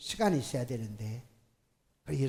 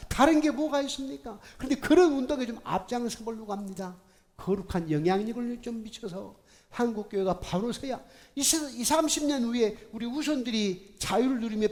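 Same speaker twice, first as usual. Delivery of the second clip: high, loud, urgent sermon call; rally the church, full force; impassioned preaching; 6 semitones higher, 6 dB louder than usual. Real, not acted. Pastor rallying the congregation to reform, loud preaching.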